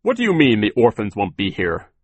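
loud, firm challenge near normal pitch; stern question. A narrator's reading. Mister Poe challenges Sir about the orphans, stern and loud. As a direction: demand an explanation sternly. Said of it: "Mister Poe asked sternly".

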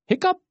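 high, sharp hiccup rising; a sudden hiccup with a rising pitch; squeaky hiccup. Acted. Fox hiccups in the story.

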